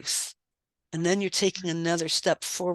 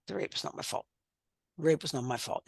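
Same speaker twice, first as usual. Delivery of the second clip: quiet, half-whispered going through the motions; therapist's homework said grudgingly to herself, reluctant mumble; mumbled out of obligation; 3 semitones lower, 6 dB quieter than usual. Retold or imitated. Dutiful unconvinced murmur. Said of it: "just saying as I had to, out of obligation".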